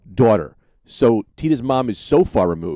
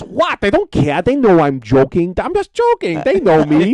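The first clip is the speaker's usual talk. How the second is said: high, loud brushing-it-off voice; Tita's mom; 8 semitones higher, 5 dB louder than usual. Retold or imitated. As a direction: shrug it off, pitched up and loud. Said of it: "And she goes".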